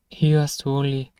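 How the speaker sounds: ordinary talk, nothing marked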